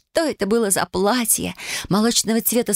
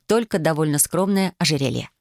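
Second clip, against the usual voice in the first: ordinary delivery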